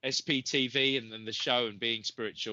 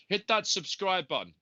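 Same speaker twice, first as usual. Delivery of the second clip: higher, punchy YouTuber call with a hit on that; badly faked YouTuber accent, pitched up and a touch louder; kids' YouTuber voice; 7 semitones higher, 3 dB louder than usual. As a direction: mimic a hyped kids' YouTuber, a bit badly. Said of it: "my terrible, uh, accent of some of my kids' YouTubers".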